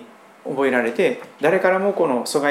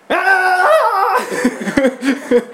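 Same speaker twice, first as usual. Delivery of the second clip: imitated baby cry; 12 semitones higher, 4 dB louder than usual. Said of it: "お乳くれる時まで絶対泣き止まないよ".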